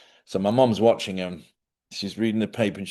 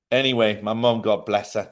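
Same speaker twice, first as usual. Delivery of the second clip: ordinary talk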